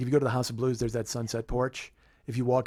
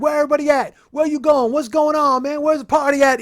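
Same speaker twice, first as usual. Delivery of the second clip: rowdy, high-pitched shouted rambling; 12 dB louder than usual. Retold or imitated. Rapper rambling at nobody in the hallway, high rowdy holler. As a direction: fire off loud questions way up high. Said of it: "He's just kinda talking".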